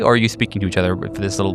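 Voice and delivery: ordinary talk, nothing marked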